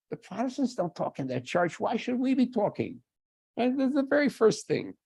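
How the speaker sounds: a hint of smug reformers asking why talk; mock reformer logic. Voicing reformers' view of church.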